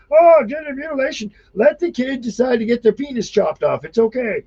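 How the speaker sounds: high, mock-breezy dismissive voice; mocking governors. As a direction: squeak it out like a smug official brushing it off. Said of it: "You have governors and states being like".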